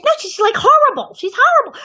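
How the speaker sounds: put-on very high, emphatic child's protest; shocked insistence. Twelve-year-old telling her father about the awful character, high shocked insistence.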